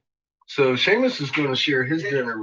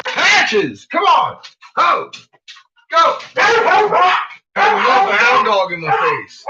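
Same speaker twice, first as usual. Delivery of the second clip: high excited yelling; 14 semitones higher, 7 dB louder, slower than usual. Real, not acted. Shouting at dogs.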